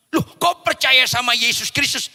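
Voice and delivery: loud, high imitated astonishment; astonished. Captor questioning the prisoner's faith, loud astonishment. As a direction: react as a startled captor.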